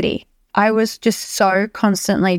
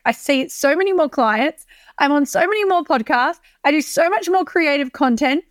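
rising excitement; 8 semitones higher than usual. Real, not acted. Building excitement about Bali.

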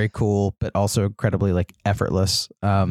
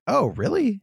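much higher, imitated delight; mock surprise; 12 semitones higher than usual. The podcaster recalling his reaction to being recognized, in mock high surprise. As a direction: pop up high in a retold gasp of surprise.

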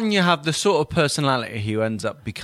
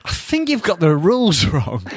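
higher-pitched, mock hesitant correction; imitated assistant referee; 6 semitones higher, 3 dB louder than usual. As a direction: raise a doubt as a lightly voiced assistant. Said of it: "their own assistants going".

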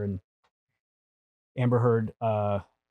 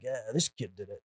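quiet Tom quote; 3 semitones lower, 12 dB quieter than usual. Retold or imitated. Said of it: "He's like".

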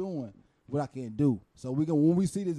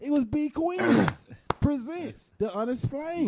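show announcer; 10 semitones higher, 4 dB louder, slower than usual. Pretend. Announces her show title.

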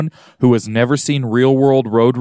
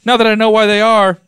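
high, loud holler across the lab; calling out; 10 semitones higher, 5 dB louder than usual. Acted. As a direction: shout it after someone who is leaving. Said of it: "scientist number one calls out to her".

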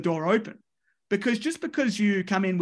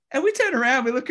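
raised and louder, caught up in the story; excited storytelling; 6 semitones higher, 4 dB louder than usual. Real, not acted. Podcast guest recalling the meeting, excitedly building up the scene. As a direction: tell it like the big moment is coming.

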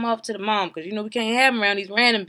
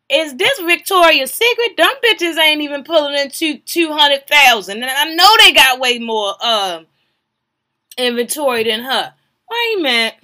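loud mocking rant; 5 semitones higher, 10 dB louder than usual. Real, not acted. Loud mocking reaction to the store.

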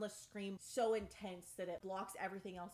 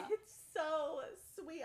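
slow, high, gushing; doting; 8 semitones higher, 4 dB louder, slower than usual. Real, not acted. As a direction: linger lovingly on "so sweet". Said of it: "doting on Andarna".

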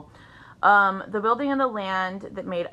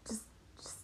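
whispered; 24 dB quieter than usual. Real, not acted. Whispering to herself.

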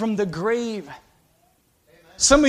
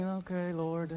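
softer, bored okay Lord; half-hearted mock prayer; 5 semitones lower, 15 dB quieter, slower than usual. Preacher mocks churchgoers going through the motions, half-hearted. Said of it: "We're just singing".

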